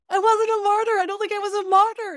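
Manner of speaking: high, loud and excited, a touch fast; proud announcement. Retold or imitated. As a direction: burst out with proud relief at the ninth month. Proudly telling daughter she changed.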